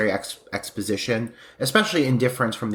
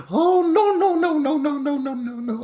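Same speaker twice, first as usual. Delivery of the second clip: high frantic mock no. The hostess squealing no at the guest. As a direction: squeal it like a panicking woman, trailing off. Mimicking a panicked character.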